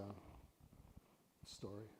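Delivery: slow soft whisper; hushed intro. Shown as a demonstration. Teacher whispers the start of a story. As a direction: lean in and whisper it.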